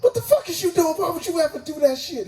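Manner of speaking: squeaky, indignant disbelief at his friend; mock-outraged squeal; high-pitched outrage. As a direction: shriek it up high like you can't believe it.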